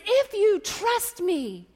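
high, drawn-out proclamation; Jesus. Retold or imitated. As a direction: call it out slowly to a crowd. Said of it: "if Jesus is shouting it out".

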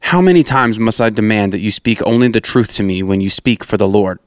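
ordinary delivery

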